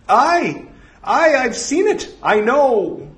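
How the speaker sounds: high, loud, eager cries; zealous high-pitched devotee; fanatical drone. Acted. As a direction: answer the voice in a high, fervent cry.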